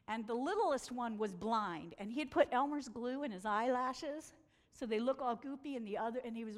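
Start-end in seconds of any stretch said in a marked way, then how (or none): none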